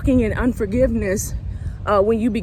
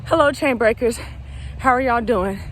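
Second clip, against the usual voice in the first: ordinary talk, nothing marked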